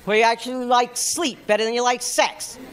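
normal talking voice

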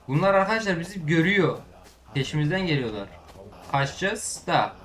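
ordinary talk

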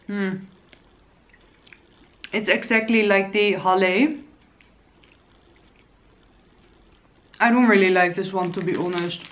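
talking while chewing biscuit; words muffled by food; mouth full, chewing. Real, not acted. Seen 2: Bites at -4, hand over chewing mouth, chewing continues while talking.

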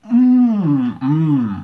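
high loud hum of pleasure; loud savoring mmm. Real, not acted. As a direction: hum with delight at the taste. Savoring a childhood favourite.

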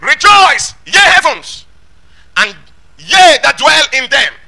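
high, loud, drawn-out proclamation; ringing herald-like scripture call; heavenly announcement. Acted. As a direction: shout it out as a grand announcement. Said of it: "what was the announcement".

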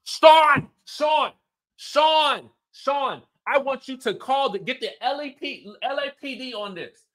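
hammed-up frantic diva demanding police; Lisa, hysterical. Lisa to her husband Sean, demanding he call the police. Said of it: "Lisa's like".

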